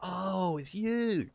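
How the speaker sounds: high, drawn-out fake surprise; mock recognition. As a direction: pretend you suddenly recognise them. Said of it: "I'm like".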